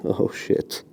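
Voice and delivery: breathless whispered curse; strained whisper. Real, not acted. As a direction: gasp it out under strain. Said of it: "This is hard".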